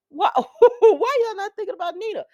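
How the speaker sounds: high, loud and laughing; laughing at the characters ignoring Nina; laughing question. Real, not acted. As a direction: laugh it out in disbelief. Repeats her question, laughing, louder.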